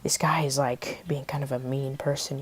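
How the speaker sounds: whispered inner thought; hushed, voiceless aside; thinking to self. Retold or imitated. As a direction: whisper it privately, as if only thinking it. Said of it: "thinking in my head".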